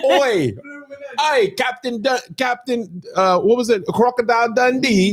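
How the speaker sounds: high, hammy Australian accent; mock Australian hail. Shown as a demonstration.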